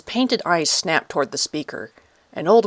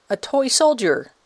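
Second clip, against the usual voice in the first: put-on high child's voice, delighted; excited child; 7 semitones higher, 3 dB louder than usual. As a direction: call out like a thrilled kid. Boy delighted by toy soldier.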